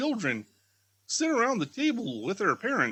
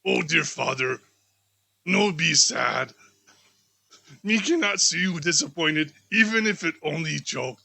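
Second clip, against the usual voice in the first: tender daughter; 4 dB louder than usual. Acted. Martha comforts her father.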